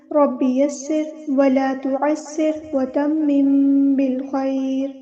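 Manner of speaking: slow, drawn-out chanted prayer, rising for the verse opening; melodic recitation. Acted. Teacher opens the lesson with slow chanted prayer. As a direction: intone each phrase slowly like a qari.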